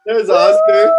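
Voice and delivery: very high, loud delighted call; excited squeal. Real, not acted. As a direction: squeal at the cat. Excited greeting at midnight.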